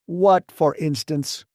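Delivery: an angry boss demanding an example; stormed. A narrator's reading. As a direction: bark the question slowly, angrily. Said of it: "stormed Burbank".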